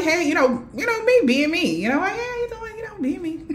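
high, chirpy mock hello to strangers; bubbly greeting. Me being me, greeting strangers in a dream, high and chirpy. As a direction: breeze in all friendly and high.

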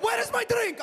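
put-on very high, accented shout; yelling Persian customer. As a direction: yell at the waiter in a mock Persian voice. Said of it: "They just yell at you".

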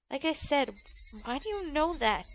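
a hint of a creepy girl pressing. Girl presses her question.

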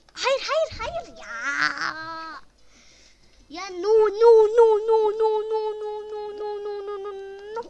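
high, loud no no no fading down; panicked yelling. Real, not acted. Gamer screaming no at the screen, fading. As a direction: shout in panic, then fade.

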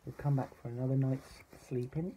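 ordinary delivery, nothing marked

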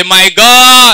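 loud, high call and response; demonstrating a loud my God. Shown as a demonstration. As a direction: shout it to the congregation. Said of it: "Somebody say with a loud voice".

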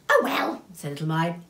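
high, offhand little voice; careless. Acted. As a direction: shrug it off, cheeky. Little My shrugs off death.

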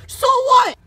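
high, loud snapping retort; loud bratty comeback; defiant shout. Acted. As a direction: throw it back defiantly.

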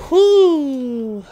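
long falling sigh of relief; relieved whoop. Real, not acted. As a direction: let out a long high whoo. Recovering after panicking.

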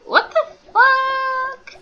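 high, drawn-out outcry; incredulous high wail; shocked squeal. Real, not acted. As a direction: stretch it into a loud high squeal. Shocked at game event.

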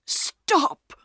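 high, abrupt cut-in; sudden interruption. A narrator's reading. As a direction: break in suddenly to halt the speaker. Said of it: "Perks said suddenly".

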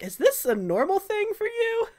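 high-pitched incredulous question; acted disbelief climbing high; baffled. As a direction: ask it in a squeaky, bewildered voice. Baffled wizard questioning burning head.

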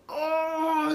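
a high cry of pain. Retold or imitated. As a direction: gasp out as the laser burns. Reacting to laser pain. (quejido agudo de dolor)

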